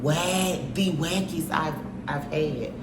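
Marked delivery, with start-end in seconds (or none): 0.0-0.6 sharp loud single-word jab; loud punchline. Acted. Roasting the ex, loud final punch. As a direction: hit "Whack" hard.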